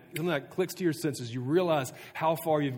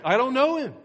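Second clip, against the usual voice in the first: loud, high-pitched outburst; stronger denial; 13 semitones higher, 9 dB louder than usual. Acted. Peter swearing to bystanders with an oath, emphatic shout. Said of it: "This time even stronger".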